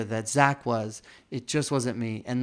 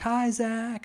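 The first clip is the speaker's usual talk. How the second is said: high, sing-song hello; cheerful greeting; 10 semitones higher than usual. Retold or imitated. Staff greeting Zach as he walks in, high cheerful hello. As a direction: call out brightly to the boss.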